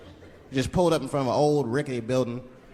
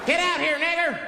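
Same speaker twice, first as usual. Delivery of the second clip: high-pitched hostile shout; sudden, much higher acted yell; menacing yell; 12 semitones higher than usual. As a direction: yell a threat from outside the house. Hooded men yell at caller.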